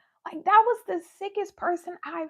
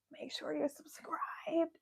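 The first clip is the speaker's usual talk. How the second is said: whispering; 4 semitones lower, 12 dB quieter, slower than usual. Real, not acted. Outro call to subscribe.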